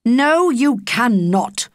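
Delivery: slow, loud, stern father; stern refusal. Acted. A father flatly refusing his daughter, slow and stern. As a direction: shut her down slowly, word by word.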